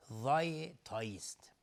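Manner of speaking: usual speaking voice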